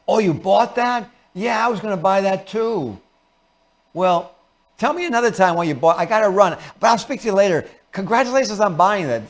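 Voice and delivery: high, falsely friendly chatter; covert hostility. Acted. False friend congratulating a purchase, two-faced sweetness. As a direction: gush insincerely while brushing them off. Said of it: "Covert hostility".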